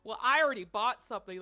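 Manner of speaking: loud, fast indignant retort; irate customer. Retold or imitated. Banned customer insisting to the clerk, loud irate retort. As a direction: snap back like an annoyed banned customer. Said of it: "he started getting a little bit irate".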